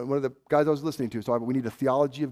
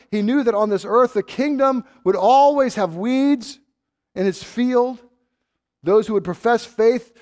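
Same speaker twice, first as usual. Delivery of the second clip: loud, high, driving sermon climax; fiery preaching; 9 semitones higher, 11 dB louder than usual. Acted. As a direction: preach it with full force. Preaching at full force.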